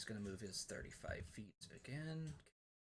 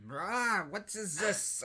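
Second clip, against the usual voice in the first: sudden high shriek of a startled hag; angry hag voice; 10 semitones higher, 9 dB louder, slower than usual. Retold or imitated. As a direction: screech it like an irritated old witch. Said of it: "just, like, going like".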